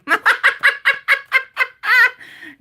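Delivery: high squealing burst of laughter; loud laugh. Real, not acted. Reader cracking up at a chat comment, loud high laugh. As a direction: let out a loud, high-pitched cackle.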